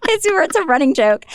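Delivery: can barely get it out for laughing; real giggles breaking up the line. Real, not acted. Laughing while explaining the prank.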